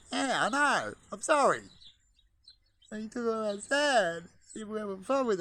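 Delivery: high sing-song talk to the birds. Real, not acted. Apologizing to the pigeons for taking their sand, high baby talk.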